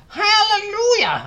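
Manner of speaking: very high, loud jubilant shout; drawn-out cry of joy; Aunt Spiker shouting. A narrator's reading. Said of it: "Aunt Spiker shouted".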